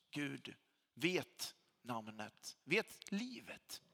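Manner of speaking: usual speaking voice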